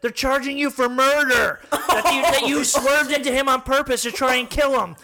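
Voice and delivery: loud, high mock-distraught accusation; retold yelling. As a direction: yell the accusation in comic retelling. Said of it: "she's crying and she's yelling at me".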